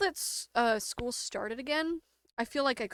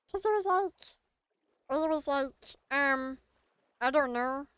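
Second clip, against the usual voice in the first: attempted cartoon alien voice; half-successful little creature impression; Stitch; 3 semitones higher than usual. Shown as a demonstration. Streamer trying a childhood impression, shaky-confidence Stitch attempt. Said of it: "I thought I could do a really good Stitch one".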